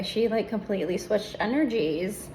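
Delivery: ordinary talk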